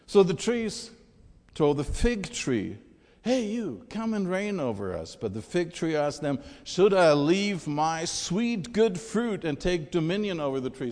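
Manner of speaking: loud, emphatic fable storytelling; dramatic scripture reading. Acted. Preacher reads Jotham's fable of the trees aloud, dramatic storytelling.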